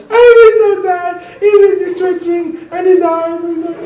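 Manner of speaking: put-on high, slow child's wail; little daughter. Father imitates his six-year-old daughter in a high wailing voice. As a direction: play a six-year-old describing the dead pet, voice up high. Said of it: "Very small".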